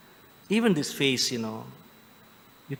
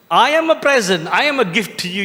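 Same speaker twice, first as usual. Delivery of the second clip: mock dad's answer; 5 semitones higher, 5 dB louder than usual. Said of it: "don't go back and say".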